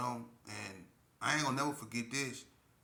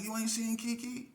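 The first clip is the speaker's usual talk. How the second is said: hollering; 9 semitones higher than usual. Retold or imitated. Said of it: "I'm hollering at him like".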